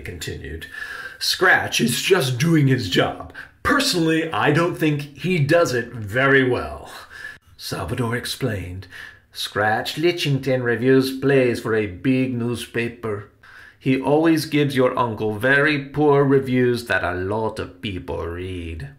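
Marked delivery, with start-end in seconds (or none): 1.2-6.9 loud, higher, pointedly diplomatic uncle; projected, measured actor uncle; restrained Uncle Riley. Acted. Uncle restrains his rant. 9.3-18.9 louder, lightly voiced Salvador; emphatic character voice, explaining; explanatory Salvador.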